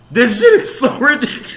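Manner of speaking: high, laughing exclamation; cracking up. Real, not acted. Mocking a politician's country accent, cracking up. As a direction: lose it laughing as you speak.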